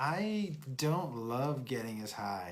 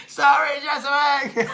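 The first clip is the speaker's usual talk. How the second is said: shouted while laughing hard; yelling through laughter; 13 semitones higher, 15 dB louder, slower than usual. Real, not acted. Laughing apology to friend.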